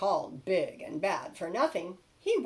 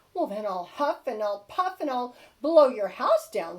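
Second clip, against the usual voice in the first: louder menacing pig threat; pig threatening; 3 semitones higher, 9 dB louder than usual. Acted. Big bad pig threatens the wolves, louder. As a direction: boom out the threat like a bully pig. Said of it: "said the pig".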